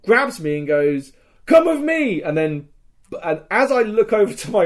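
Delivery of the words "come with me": high, loud rescuer's order; urgent call. Retold or imitated. Rescuer grabbing a wounded fighter, urgent high shout.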